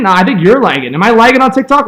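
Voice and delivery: normal talking voice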